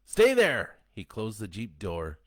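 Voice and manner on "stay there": high, loud shouted order; sharp command. A narrator's reading. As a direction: bark it at the kids. Len commanding the kids.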